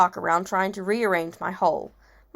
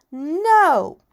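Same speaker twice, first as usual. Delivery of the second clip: put-on high, loud toddler's "no"; loud refusal; 8 semitones higher, 9 dB louder than usual. A narrator's reading. Little boy refusing the shoes, loud protest. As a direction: blurt it out high and stubborn.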